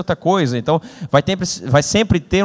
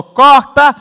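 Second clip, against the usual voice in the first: high, loud call of cut; loud film director's cut; director shouting cut; 8 semitones higher, 9 dB louder than usual. Retold or imitated. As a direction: yell it like a director on set.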